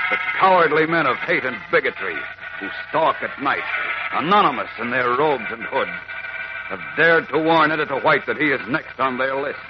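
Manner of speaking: ordinary delivery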